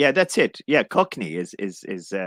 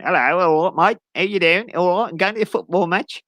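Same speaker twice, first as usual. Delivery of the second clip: higher-pitched Cockney matey greeting; Cockney accent; 6 semitones higher than usual. Acted. Londoner chatting about the football match, higher-pitched Cockney accent. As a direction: greet a mate in broad Cockney. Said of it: "that's that type of accent".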